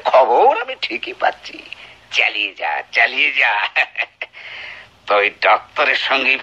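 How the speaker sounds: taunting a wife he suspects; sneering taunt. Acted. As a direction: needle her with contempt. Husband taunts wife about doctor.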